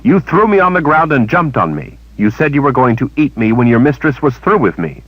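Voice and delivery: a touch of outrage; Kalen, indignant. A narrator's reading. Kalen cutting in to accuse the creature, indignant. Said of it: "Kalen put in".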